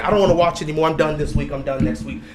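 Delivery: low mock grumble, imitating whiny quitters; mimicked complainer. Host mocks fans who keep threatening to quit, mimicked complaining.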